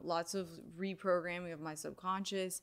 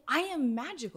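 awed realization; 6 semitones higher than usual. Retold or imitated. Retelling her moment of awe.

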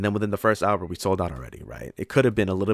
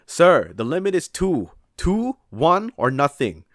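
high, louder and slow firm refusal; a put-on stern clerk voice, pitched up; imitating the assistant manager; 9 semitones higher, 6 dB louder, slower than usual. Assistant manager telling the tourist two or nothing, mock stern.

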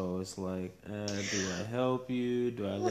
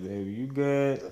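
concerned call; 5 semitones higher, 6 dB louder than usual. Retold or imitated. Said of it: "And I was like".

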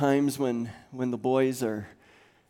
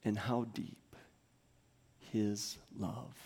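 softer, drawn-out prayer; hushed prayer; 7 dB quieter, slower than usual. Acted. Pastor praying love's measure over church, hushed. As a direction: let each phrase fall away quietly.

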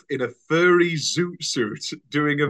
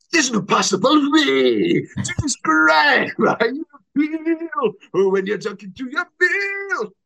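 unnamed impression; 11 semitones higher, 4 dB louder than usual. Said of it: "I've got one".

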